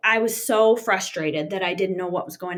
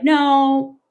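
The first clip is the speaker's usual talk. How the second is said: a louder, higher "no"; retold disbelief; 6 semitones higher, 8 dB louder than usual. Wife refuses the news of the death, retold disbelief. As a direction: refuse to believe it. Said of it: "who's gonna believe that".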